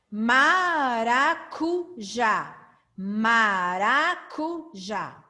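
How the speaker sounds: "Cometa" and "Maracujá" slow, syllables drawn out; emphasised K syllable. Shown as a demonstration. Teacher giving examples of C sounds, slow emphatic "k". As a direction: make the "k" in "Cometa" and "Maracujá" hard and clear. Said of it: "você vai perceber esse som".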